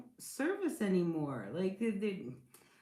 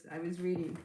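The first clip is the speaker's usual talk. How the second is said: ordinary talk, nothing marked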